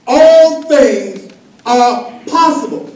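slow, loud, each word weighted; slow declaration. Acted. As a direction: proclaim it slowly to the church. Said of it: "congregation declaration".

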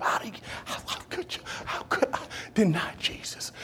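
hushed, anguished near-whisper; mock bitter weeping. Preacher voicing Peter's remorse at denying Jesus, hushed and anguished. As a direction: act out anguish in a faint, broken-up whisper. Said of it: "he wept bitterly".

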